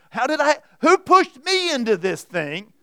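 high, loud mock protest; acted-out indignant excuse, pushed high; making excuses. Preacher voices someone panicking at a huge task, loud protesting excuse. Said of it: "making excuses about".

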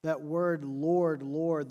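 normal talking voice

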